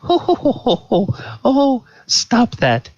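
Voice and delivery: high coquettish titter; hammed-up flirty "oh, stop it"; snickering tipsy lady. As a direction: giggle in falsetto like a flattered lady. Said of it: "she is snickering at things".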